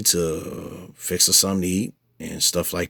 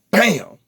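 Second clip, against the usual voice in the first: punch sound effect; 11 semitones higher, 4 dB louder than usual. Retold or imitated.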